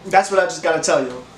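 ordinary delivery

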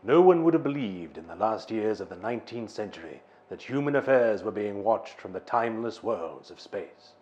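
reading in a Welsh accent; solemn Welsh accent narration. Shown as a demonstration. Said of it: "Do you want to hear my Welsh accent?".

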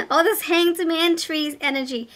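delighted laugh spilling into the line. Real, not acted. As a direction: giggle your way through the words. Excited laugh over tree card.

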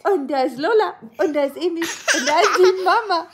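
high singsong broken by giggles. Real, not acted. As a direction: giggle while cooing names. Mother naming family to baby.

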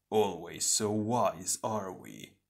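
slow, sardonic taunt; slow mockery that drops away; mocking shadow twin. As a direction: draw it out, sneering quietly. Shadow twin mocks hero.

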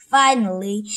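plain reading voice

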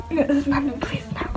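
fading to a whisper; whispered plea. Acted. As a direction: beg softly, almost gone. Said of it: "gonna get you a clean".

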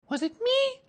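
put-on very high, childlike question; a little surprised. A narrator's reading. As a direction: squeak it in innocent surprise. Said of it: "a little surprised".

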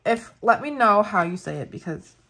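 ordinary delivery

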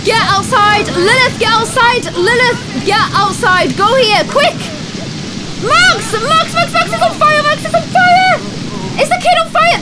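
high frantic screams; panicked screaming. Real, not acted. Max on fire.

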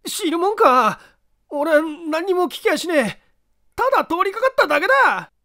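loud high panicked denial; too loud. Acted. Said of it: "大きな声を出すな".

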